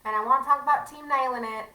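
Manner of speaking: loud, exaggerated Southern twang; Taylor. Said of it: "every time I hear Taylor talk, I start talking like this".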